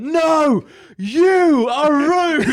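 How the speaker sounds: mock retort. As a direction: throw the insult back in a high, slow mocking voice. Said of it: "And I just went".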